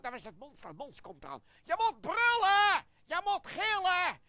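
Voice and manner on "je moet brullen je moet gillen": loud, high shouted command; big raised-voice urging; shouted prompt. Acted. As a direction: yell the cue to a fellow player. Prompts the monster to roar.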